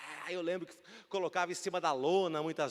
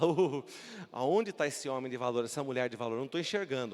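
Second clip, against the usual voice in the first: doubtful believer; 8 semitones lower, 4 dB quieter than usual. Retold or imitated. Doubting believer voiced, lower.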